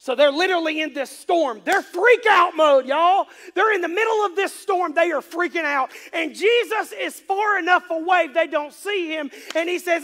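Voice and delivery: very loud, high sermon storytelling; full-force preaching. Real, not acted. Preacher retells disciples in the storm to congregation, full-force preaching. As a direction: shout the story to the back pew.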